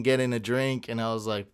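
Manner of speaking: usual speaking voice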